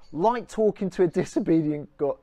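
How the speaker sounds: normal talking voice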